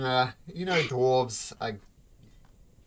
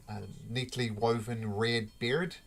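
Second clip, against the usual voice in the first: ordinary talk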